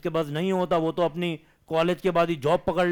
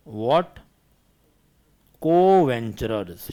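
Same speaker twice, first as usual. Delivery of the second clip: slow dictation; 4 semitones lower, much slower than usual. Acted. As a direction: dictate word by word, with long pauses. Dictating notes to the class.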